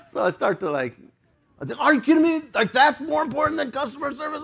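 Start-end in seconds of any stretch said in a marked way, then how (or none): 1.8-4.4 high-pitched indignant customer rant; mock a customer starting to blow up; full Karen mode. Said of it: "go full Karen mode".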